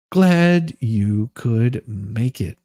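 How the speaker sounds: ordinary delivery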